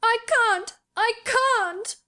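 put-on very high, wailing child voice; gasping and sobbing. A narrator's reading. Hysterical boy protesting to the girl, sobbing. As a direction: wail helplessly, like a sobbing boy. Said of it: "he gasped and sobbed".

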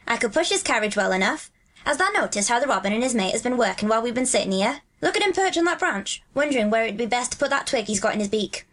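broad Yorkshire dialect, lilting; Dickon, friendly country lad. Acted. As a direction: chat like a Yorkshire moor boy. Voices Dickon in dialogue.

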